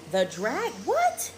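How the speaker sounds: a baffled mother repeating her son's words; incredulous what. Retold or imitated. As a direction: squeak it out in disbelief. Hearing "the Dragon Show.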